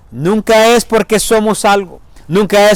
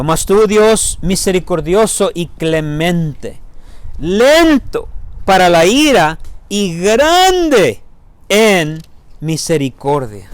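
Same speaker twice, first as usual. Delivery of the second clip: drawn-out and emphatic; reading Psalm eighty-six to the church, slow and weighty; slow, weighty scripture reading; slower than usual. Real, not acted. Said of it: "escúchenlo".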